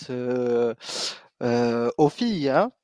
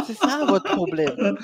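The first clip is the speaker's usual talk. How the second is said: laughing; 7 semitones higher, 3 dB louder, slower than usual. Real, not acted. Laughing while calling it out.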